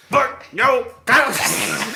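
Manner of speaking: excited shout. Retold or imitated. Slamming the broom.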